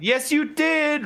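high, drawn-out mock insistence; slow, high-pitched retold taunt; teasing insistence. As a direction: imitate a taunting comeback, stretched high. Acting out Ellie teasing Joel.

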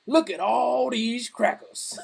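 slow, clueless blurt; a slow oblivious blurt. Retold or imitated. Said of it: "blurts out".

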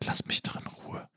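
angry whisper; whispered bickering. Pretend. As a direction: argue in a whisper. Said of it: "Aber flüsternd".

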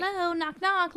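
high, singsong hello; lightly voiced spirit knocking; mock spirit knocking. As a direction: play a spirit calling at the door. Voicing a spirit at the door.

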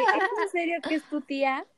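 asked high, in shocked disbelief; can't believe it's his aunt; incredulous disbelief. Retold or imitated. (incredulidad aguda)

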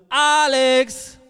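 loud, high, drawn-out call; insistent child's call. Retold or imitated. Toddler summoning his papa from bed, loud insistent call. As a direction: yell it like a demanding toddler. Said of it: "zarte, aber laute und bestimmende Stimme".

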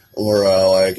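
ordinary talk

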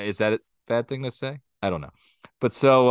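usual speaking voice

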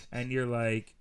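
ordinary talk

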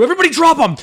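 loud, high alarm call; panicked mock warning; mock shout. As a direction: yell to the crew to drop the bagels. Yelling at crew.

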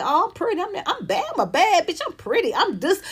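high-pitched, preening show-off; mock boasting. Acting out a woman bragging she's pretty, boastful. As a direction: sing your own praises up high.

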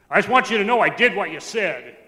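very loud, forceful imitation of an uncle; booming announcement, mimicking a relative; imitated blurting uncle. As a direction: blurt it down the phone at full volume. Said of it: "He's just like that".

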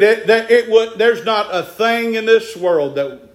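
rising, ringing preacher's cadence; preaching. Real, not acted. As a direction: preach it to the congregation. Preaching at full force.

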